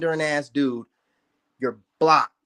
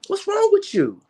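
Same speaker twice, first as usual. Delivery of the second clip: very high-pitched, incredulous scolding; high, indignant exclamation; exasperated rebuke. Real, not acted. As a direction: pitch it way up in disbelief.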